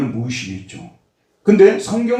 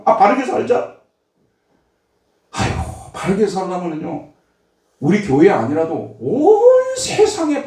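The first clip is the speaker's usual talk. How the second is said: high-pitched, impassioned pulpit rebuke; forceful preaching; 9 semitones higher than usual. Acted. Preacher challenging believers who never pray against temptation, forceful preaching. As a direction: press the challenge on the congregation, high.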